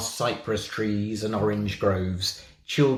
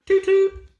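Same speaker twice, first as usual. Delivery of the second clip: very high, loud whistle toot; train whistle toot; 7 dB louder than usual. Acted. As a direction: toot like a steam engine whistle.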